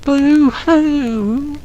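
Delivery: high wailing boo-hoo; crying boo-hoo. Acted. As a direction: wail it like a sobbing child. The little who crying.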